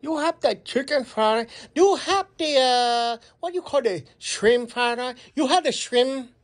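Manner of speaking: customer's put-on Chinese accent. Customer phoning restaurant to order, in a Chinese accent. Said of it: "we're gonna do a Chinese accent".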